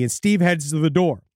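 ordinary talk

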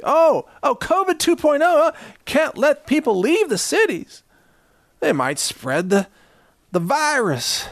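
high-pitched mock panic; mock alarm. Mocks officials' excuse for blockade.

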